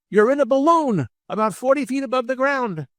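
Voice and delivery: high, sing-out call up to the sky; mock holler. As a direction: pretend to shout an answer to someone far above. Joke, bystander calls up to balloon.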